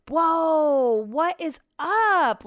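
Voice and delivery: high, drawn-out mock whoa; sarcastically surprised, stretching it out; mock indignant whoa; mock exaggerated shock. Mocking his grumpy complaint.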